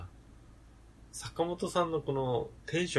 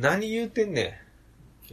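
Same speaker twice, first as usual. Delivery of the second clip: higher, lightly put-on Kansai retort; mock Kansai tsukkomi; 7 semitones higher than usual. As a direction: try out a fake Kansai comeback. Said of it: "僕らも新しいツッコミ".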